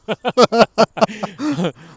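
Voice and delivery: high long laugh; loud laughter. Real, not acted. Laughing at own joke.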